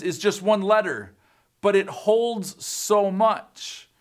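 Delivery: normal talking voice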